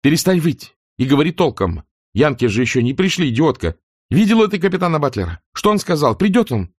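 high, sharp furious scolding; angry scolding. Acted. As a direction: shake her and snap orders. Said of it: "сильно тряхнула".

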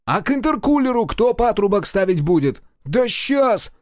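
high-pitched exasperated retort; indignant, high-pitched bickering; acted squabble. Mechanics squabbling over the repair.

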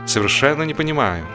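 ordinary delivery

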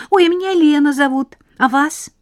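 put-on high, bright, rapid girl's chatter; chatty little girl. Lena introduces herself.

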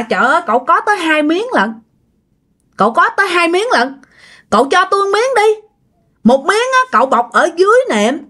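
put-on high, wheedling young woman's voice begging for a favour; pleading young woman. Old woman at the door begs the young man for his mattress cover, high and wheedling. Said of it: "giọng nói này giống như là người tầm khoảng chừng mười tám".

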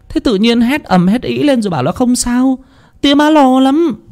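high, fretful mother's voice; mother fussing over her son; worried mother. Acted. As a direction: scold him out of worry.

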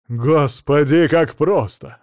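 loud, slow groan of regret; drawn-out exasperated groan; moaning lament. A narrator's reading. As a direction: moan it, stretching the first word. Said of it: "застонал Мамонов".

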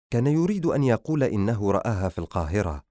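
usual speaking voice

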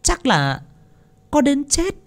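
high, soft witch-like voice; gloating wicked woman. Acted. Wicked wife gloating to her lover, high and hushed. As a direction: purr over the stolen fortune.

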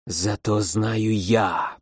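low, slow hissing darkness; creeping nightmare whisper. A narrator's reading. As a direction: hiss it slowly from the dark. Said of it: "прошипела тьма".

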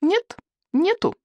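put-on very high, slow childish objection; stubborn high boy voice; boy contradicting. A narrator's reading. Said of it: "возразил Икинг".

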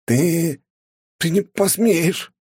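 медленный стон от боли, выше обычного; выдавить сквозь острую боль; стонущий. A narrator's reading. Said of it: "простонал парень". (простонать от боли)

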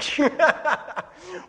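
high, loud burst of laughter; real high-pitched laugh. Real, not acted. Laughs at own story.

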